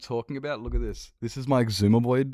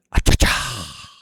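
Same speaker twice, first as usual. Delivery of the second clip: Ace Ventura impression; 2 semitones lower, 9 dB louder, much slower than usual. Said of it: "It's like, I told you, Ace Ventura".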